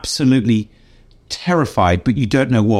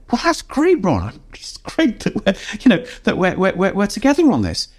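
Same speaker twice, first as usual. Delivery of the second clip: flustered reply; 9 semitones higher than usual. Retold or imitated. Speaker's flustered reply, laugh.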